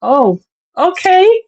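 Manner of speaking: high, slow, unconvinced reply; retold polite bewilderment; hesitant acceptance. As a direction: draw it out, doubtfully. Underwhelmed reply to excited friend.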